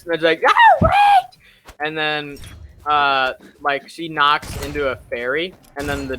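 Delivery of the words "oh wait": very high, loud cry; mock panicked shout. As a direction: shriek it out as the witch. Acted witch crying out.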